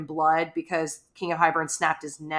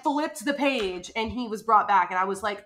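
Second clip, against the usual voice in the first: normal talking voice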